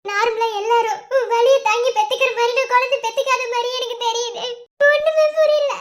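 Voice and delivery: distraught plea broken by sobs; crying hard. Acted. Wife asking her husband why motherhood was denied her, crying hard. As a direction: plead tearfully, catching sobs between phrases. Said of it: "இப்ப ஏன் இவ்வளவு அழுதுட்டு இருக்க".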